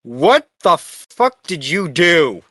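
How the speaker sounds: normal talking voice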